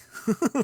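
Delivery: high bright laugh; a real laugh breaking out; burst of laughter. Real, not acted. Laughing at his own daydream, a sudden high laugh.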